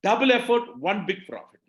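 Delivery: rally the room with the mantra; slogan punch. Real, not acted. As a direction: hit double hard, then let the rest fall. Slogan repeated to the audience.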